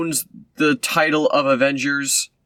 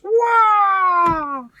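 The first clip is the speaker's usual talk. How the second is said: mock fanfare cry. Mocking the villain's flourish at the press conference. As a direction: belt a cartoon "wah" flourish. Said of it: "she's like".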